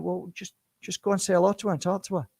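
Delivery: ordinary talk, nothing marked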